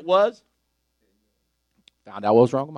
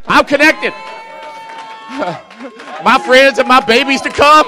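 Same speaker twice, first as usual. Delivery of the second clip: higher and louder, fired-up sermon; full-force preaching; 10 semitones higher, 10 dB louder than usual. Acted. Preacher lifting the church, fired-up preaching. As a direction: preach it out with rising fire.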